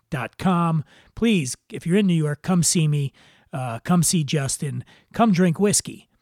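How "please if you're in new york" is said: ordinary delivery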